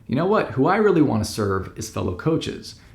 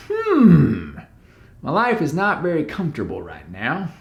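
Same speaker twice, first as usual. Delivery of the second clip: put-on Southern accent; 4 semitones higher than usual. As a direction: play a worried client with a Southern accent. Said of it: "why am I doing the Southern accent?".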